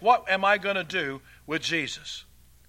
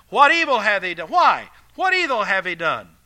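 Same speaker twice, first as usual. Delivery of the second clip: loud, higher-pitched pleading challenge; loud protest; 4 semitones higher, 11 dB louder than usual. Acted. Pilate appeals to the crowd, acted loud protest. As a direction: demand it as an indignant question.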